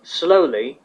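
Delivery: ordinary delivery, nothing marked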